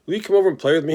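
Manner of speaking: high, hopeful little pig asking; pleading pig voice. Acted. The pig asks the goose to play, high and hopeful. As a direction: beg a friend to come play.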